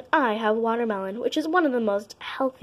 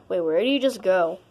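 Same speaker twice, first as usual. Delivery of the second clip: ordinary talk, nothing marked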